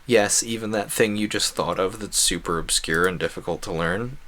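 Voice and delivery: ordinary delivery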